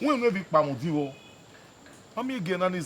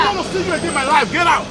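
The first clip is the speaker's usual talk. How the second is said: high, loud, fast shout; furious banishment; 9 semitones higher, 6 dB louder, faster than usual. Acted. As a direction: yell them out of your life, rushing the words. Banishing someone in a quarrel.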